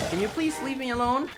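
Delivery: high put-upon whine; mock-exasperated complaint; whiny annoyed voice. Annoyed at game enemy.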